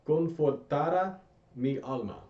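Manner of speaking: slow, clear Spanish read for repeating; teacher reading a verse slowly for students to repeat. Shown as a demonstration. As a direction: say it slowly so learners can echo it. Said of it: "I'm gonna read this slowly".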